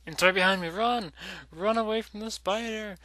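panicked shouting, high and loud; being chased. Real, not acted. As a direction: yell in panic while fleeing. Fleeing spider, screaming run.

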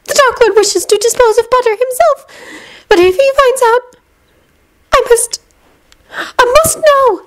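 high, urgent woman's voice; worried and insistent; Narcissa voice. A narrator's reading. Narcissa's anxious voiced dialogue.

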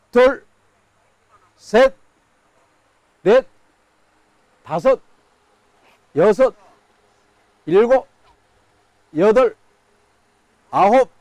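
loud countdown calls; auctioneer. Real, not acted. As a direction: call out each count to the room. Ten count for live auction.

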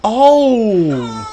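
ordinary delivery

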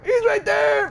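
panicked high shout; frightened shout. Real, not acted. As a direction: scream the warning. Spots the monster.

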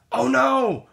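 plain reading voice

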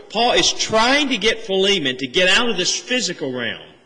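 normal talking voice

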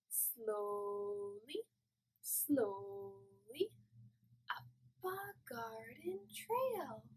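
very soft and slow, creeping like a snail; hushed slow singsong; gentle creeping whisper-song; slow soft snail verse. Shown as a demonstration. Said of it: "let's try it again".

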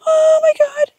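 high squeals in freezing water; wailing at the cold; in ice cold water. Real, not acted.

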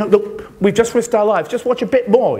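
mock urgent begging; urgent plea. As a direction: push harder with each line, mildly retold. Crew pleading with London.

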